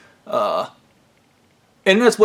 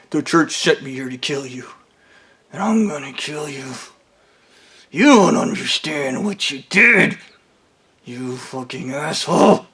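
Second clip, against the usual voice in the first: menacing killer. Retold or imitated. Coworker quietly threatens to kill.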